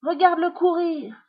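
high, delighted cry; excited exclamation. A narrator's reading. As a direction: point it out with glee. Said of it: "s'exclame Josette".

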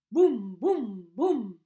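booming knocks on the door; loud knocking. A narrator's reading. Narrator booming the ogre's knocks on the door. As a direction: pound it out like fists on wood.

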